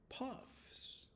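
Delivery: puffs and its spelling breathed softly; whispered dictation. Shown as a demonstration. Teacher whispering the spelling word puffs. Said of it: "the word is".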